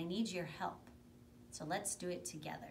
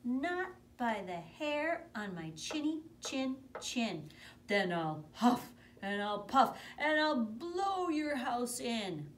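higher, loud, slow threatening voices; high stubborn pig then wolf; 7 semitones higher, 8 dB louder, slower than usual. Acted. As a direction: boom the threat out slowly.

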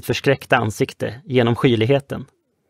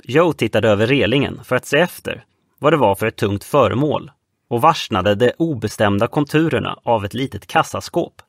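ordinary delivery, nothing marked

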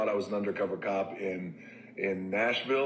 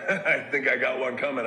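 laughing; 5 semitones higher, 6 dB louder than usual. Real, not acted. Laughing at expecting a punch.

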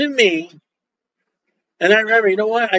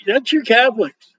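ordinary talk, nothing marked

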